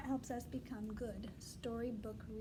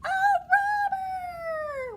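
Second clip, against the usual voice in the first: much higher, loud alarm cry; shouted alarm; 11 dB louder, much slower than usual. Shown as a demonstration. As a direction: cry out the warning again. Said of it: "one more time".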